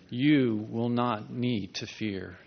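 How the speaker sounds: ordinary talk, nothing marked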